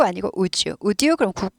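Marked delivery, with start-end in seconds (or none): 0.4-0.8 "would you" with a hard d, wu-dyu; "would you" as woo-dyoo, no j; hard North Korean-like would you. Shown as a demonstration. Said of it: "북한처럼 들려요". 0.8-1.2 "would you" with a hard d, wu-dyu; "would you" as woo-dyoo, no j; hard North Korean-like would you. Shown as a demonstration. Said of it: "북한처럼 들려요".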